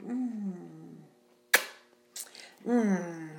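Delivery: ordinary talk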